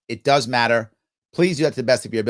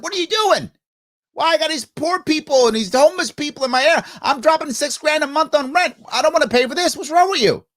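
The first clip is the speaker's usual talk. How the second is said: higher, whiny outraged rich neighbour; mock the indignant rich tenant in a pitched-up voice; angry wealthy residents; 12 semitones higher than usual. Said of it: "The wealthy up there got mad and came down and said".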